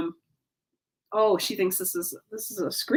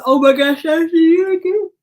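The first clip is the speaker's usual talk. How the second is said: loud, high yawn-talk; real yawn stretching and blurring the words; 9 semitones higher, 10 dB louder than usual. Real, not acted. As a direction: talk right through a big yawn. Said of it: "Sorry if my yawns are contagious".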